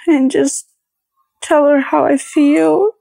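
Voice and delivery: choking back tears while speaking; getting emotional. Real, not acted. Pregnant wife missing her mom, near tears. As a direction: voice breaking up high with real tears. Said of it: "I'm getting emotional again".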